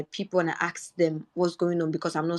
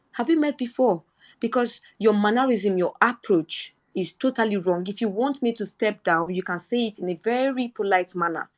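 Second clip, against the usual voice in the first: higher-pitched, retold indignant rebuke; indignant retort; 3 semitones higher than usual. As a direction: re-enact firmly challenging a rude officer. Re-enacts indignant rebuke to policeman.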